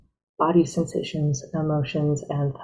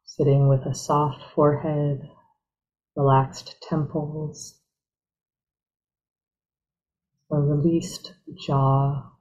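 guided meditation; 3 dB quieter than usual. Real, not acted. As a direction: ease them gently into stillness, unhurried.

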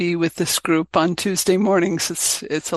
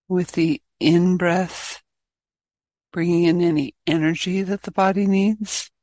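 guided meditation. Real, not acted. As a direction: guide the group gently inward, leaving space between phrases.

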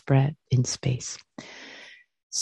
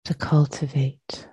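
guided meditation. Acted. Teacher leading students to arrive and release, guided meditation. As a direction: guide them gently into the body, unhurried.

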